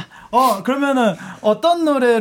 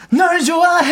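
hammed-up, higher-pitched love confession; romantic confession; 6 semitones higher than usual. Idol acting a drama love scene, hammed-up confession. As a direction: overplay the confession, letting the pitch jump.

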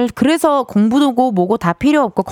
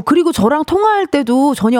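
ordinary talk

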